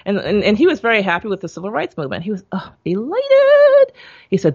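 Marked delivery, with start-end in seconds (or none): 2.8-4.0 high, loud burst of delight; elated. Retold or imitated. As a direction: squeal the word with joy. Excited father joining civil rights.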